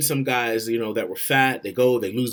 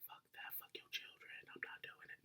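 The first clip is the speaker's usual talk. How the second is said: whisper; 30 dB quieter than usual. Real, not acted. Joking aside under his breath.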